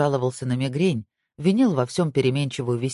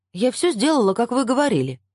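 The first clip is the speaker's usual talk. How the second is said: frightened protest; 9 semitones higher, 4 dB louder than usual. Acted. Frightened woman protests angry man.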